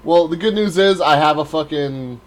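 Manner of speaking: ordinary talk, nothing marked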